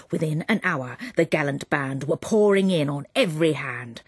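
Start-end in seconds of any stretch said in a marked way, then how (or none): none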